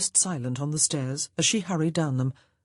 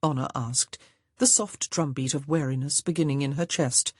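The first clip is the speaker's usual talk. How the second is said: usual speaking voice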